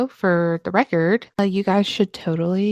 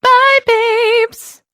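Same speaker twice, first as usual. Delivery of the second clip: much higher playful sign-off; high sing-song bye; 5 dB louder than usual. Pretend. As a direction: toss it up high and bright. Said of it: "that sounded way better".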